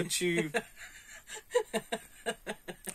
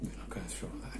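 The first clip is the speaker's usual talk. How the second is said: whispered aside; 9 semitones lower, 16 dB quieter, faster than usual. Real, not acted. Muttered aside before retake.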